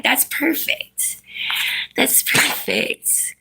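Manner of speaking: soft, slow, whispery praise; soothing praise. Real, not acted. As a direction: settle the dog with slow, hushed words. Settling the dog with praise.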